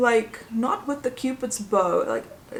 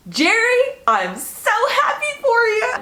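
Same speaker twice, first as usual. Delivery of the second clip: much higher, louder squeal of joy; thrilled cheer; 14 semitones higher, 6 dB louder than usual. Real, not acted. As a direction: squeal it like a proud fan. Cheering for Jerry.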